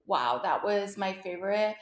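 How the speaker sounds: normal talking voice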